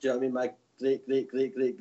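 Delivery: ordinary talk, nothing marked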